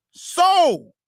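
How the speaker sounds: high ringing call of Soul; loud call to soul. Acted. Rich man calling out to his own soul, ringing.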